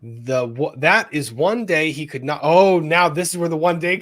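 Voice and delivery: normal talking voice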